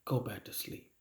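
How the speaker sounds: soft, hushed whisper. Real, not acted. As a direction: whisper it like a lullaby. Preacher soothes the congregation.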